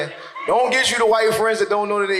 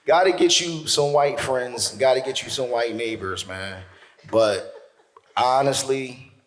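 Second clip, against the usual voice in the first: usual speaking voice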